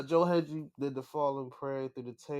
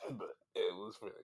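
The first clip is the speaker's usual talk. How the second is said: breaking into laughter, softer; laughing; 5 semitones lower, 9 dB quieter than usual. Real, not acted. Still cracking up at the song, quiet laughing. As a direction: giggle it out under your breath.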